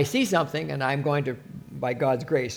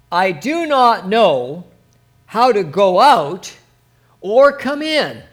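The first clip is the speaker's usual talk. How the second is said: slow, louder, lifted plea; slow pleading cry; 3 semitones higher, 7 dB louder, slower than usual. Acted. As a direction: draw out each phrase like a humble cry. Solomon now is crying out.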